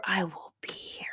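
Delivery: soft whispered promise. Real, not acted. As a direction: breathe it as a secret promise. Reassuring daughter, repeated whispered.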